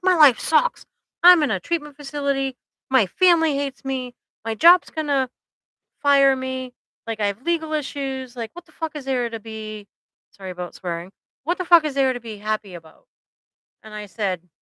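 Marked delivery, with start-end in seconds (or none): 0.0-9.9 ranting that nothing is worth anything; pissed-off client. Retold or imitated. Angry client ranting to his therapist, voice raised high. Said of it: "he was, like, really pissed". 11.4-13.1 angry rant, higher in pitch, fast; pissed-off client. Retold or imitated. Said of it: "he was, like, really pissed".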